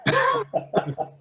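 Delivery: a big high burst of laughter; hearty laugh. Real, not acted. Laughing at his own story about the armed policeman, a big high burst of laughter.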